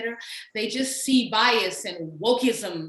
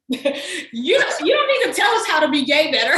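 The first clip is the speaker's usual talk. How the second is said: put-on girlfriend's words squeezed out through laughter, louder; laughing quote; 5 semitones higher, 9 dB louder than usual. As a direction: laugh while quoting the gentle rebuke. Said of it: "as my girlfriend who read it told me".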